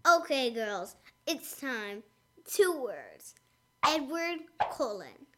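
low, dreamy heartthrob build-up; swoony low voice. Acted. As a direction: drop low and swoon for the girls.

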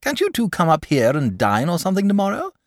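high-pitched, eager invitation. A narrator's reading. Dragon invites boy and father to dine, eager. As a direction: invite keenly, voice lifted. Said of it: "asked the dragon eagerly".

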